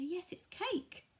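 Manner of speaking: high, slow bright naming; praising a toddler. Shown as a demonstration. Parent naming the cake for a toddler, high and slow. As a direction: sing the word out for the child.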